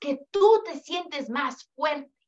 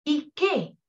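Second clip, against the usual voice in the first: ordinary delivery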